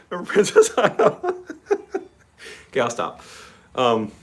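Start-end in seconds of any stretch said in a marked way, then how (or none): 0.1-1.5 laughing. Real, not acted. Laughing while teasing chat.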